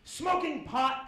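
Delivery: angry father catching his son; angry dad. Retold or imitated. Angry dad confronting stoned son.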